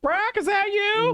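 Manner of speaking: very high, drawn-out falsetto call; mock distant call. Character calls to find Brock in the library, squeaky call. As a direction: call out squeakily as if across a hall. Calling to a far-off companion.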